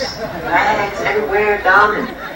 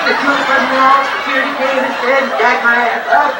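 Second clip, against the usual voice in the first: ordinary delivery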